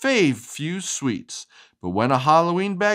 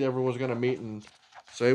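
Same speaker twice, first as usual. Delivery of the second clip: ordinary delivery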